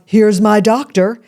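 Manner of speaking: loud, slow proud-mother announcement; slow, loud mock boast of a proud mom. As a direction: boom it out like presenting a prize. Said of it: "my mother would announce".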